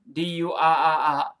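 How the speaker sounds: ordinary talk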